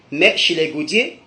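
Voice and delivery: ordinary talk, nothing marked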